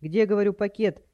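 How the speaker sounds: loud, exasperated demand; shouting. A narrator's reading. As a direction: yell at someone hard of hearing. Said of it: "закричал он во весь голос".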